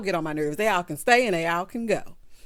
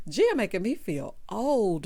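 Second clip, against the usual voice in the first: ordinary talk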